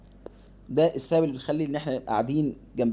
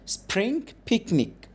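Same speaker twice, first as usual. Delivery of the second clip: slow, higher phonics read; slow model reading; 7 semitones higher, much slower than usual. Shown as a demonstration. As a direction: draw out every sound. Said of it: "ها؟". (قراءة بطيئة بصوت أعلى)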